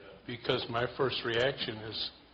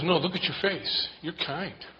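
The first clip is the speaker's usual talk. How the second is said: higher, louder insisting lady; insistent woman; 7 semitones higher, 5 dB louder than usual. Retold or imitated. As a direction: press it warmly, pitched up. Woman insists he's kind.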